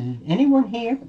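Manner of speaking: lightly acted little girl calling out. Goldilocks calls out in a house.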